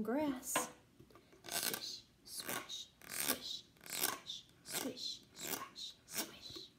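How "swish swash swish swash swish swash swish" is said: breathy whispered rhythm; whispered swish swash. Acted. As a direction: whisper the grass noises softly. Whispered grass sounds.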